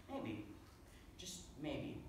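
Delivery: soft, hesitant realization; tentative. Acted. Would-be hero reconsidering his motives, tentative. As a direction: try the thought out quietly.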